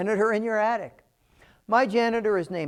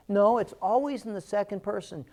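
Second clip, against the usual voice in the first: ordinary talk, nothing marked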